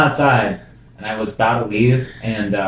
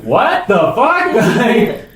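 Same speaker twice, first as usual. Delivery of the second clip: high, laughing what-the-hell; retelling his reaction, laughing; laughing disbelief; 11 semitones higher, slower than usual. Retold or imitated. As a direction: crack up while asking.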